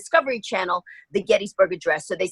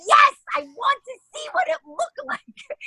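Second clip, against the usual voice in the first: thrilled shout. Retold or imitated. Actress thrilled at being offered another ride, shouting and laughing. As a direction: shout it out with delight, laughing at the end.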